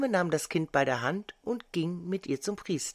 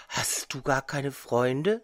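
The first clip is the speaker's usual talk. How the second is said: usual speaking voice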